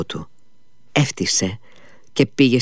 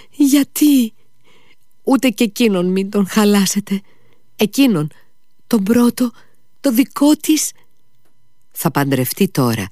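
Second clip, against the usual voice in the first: pleading cry; 9 semitones higher than usual. Acted. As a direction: beg at his feet, voice climbing. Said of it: "Έπεσε στα πόδια του φωνάζοντας". (η Φένια ικετεύει τον Μήτια, ψηλά και δυνατά)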